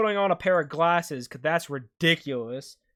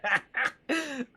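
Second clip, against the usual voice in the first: relieved laugh; 10 semitones higher than usual. Real, not acted. Relief after near disaster.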